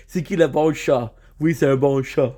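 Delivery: playful French pet talk; speaking French. Pretend. Streamer talking to a cat in French to confuse him, playful French. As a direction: switch into French to coax a cat. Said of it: "talk to him in French".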